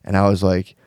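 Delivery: ordinary talk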